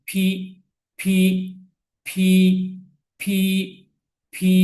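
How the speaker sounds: "ফি" with a puff of air after the p; aspirated letter P. Shown as a demonstration. Teacher drills P for learners, aspirated pronunciation. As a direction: puff the first sound of each "ফি". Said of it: "ফি বলবো".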